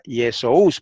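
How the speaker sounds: "Yesous" said ye-so-us, both vowels heard; wrong split diphthong. Shown as a demonstration. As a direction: sound out "Yesous" with o and u apart. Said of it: "en griego no se lee Yesous".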